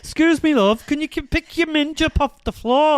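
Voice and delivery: mock northern bloke voice; northern accent. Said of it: "he was saying it in a northern accent".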